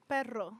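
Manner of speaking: perro with a trilled double r; rolled r. Shown as a demonstration. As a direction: roll the r in perro hard. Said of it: "I like rolling R's".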